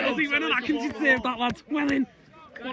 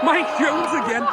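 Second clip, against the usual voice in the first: excited shout; 7 semitones higher, 3 dB louder than usual. Real, not acted. Shout after penalty save.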